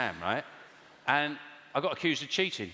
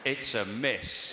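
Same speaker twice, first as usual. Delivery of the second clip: slow, savouring "It's a miss." lower and drawn out; gleeful; 5 semitones lower, much slower than usual. Retold or imitated. Comedian mimicking an audience member's gleeful call. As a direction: relish "It's a miss." like a small victory. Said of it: "You said that with so much pleasure in your voice".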